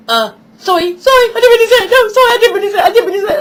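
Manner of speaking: high, loud, pleading apology; imitating a son apologising in a panic; panicky child apology. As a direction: gabble sorry like a scared little boy. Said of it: "He went".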